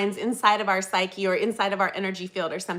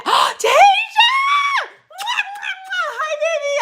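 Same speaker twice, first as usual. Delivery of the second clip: gasping squeal with kisses; high squeal of delight; surprised gasp; 7 dB louder than usual. Real, not acted. Gasps seeing viewer.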